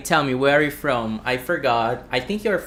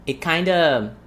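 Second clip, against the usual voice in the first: usual speaking voice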